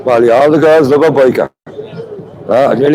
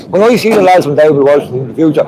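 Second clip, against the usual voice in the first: ordinary talk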